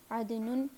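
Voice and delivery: عدنن said adnun; "un" ending. Shown as a demonstration. Teacher giving a place name, عدنن ending in "un". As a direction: end عدنن in "nun". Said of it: "تینوں طرح سے فلی flexible".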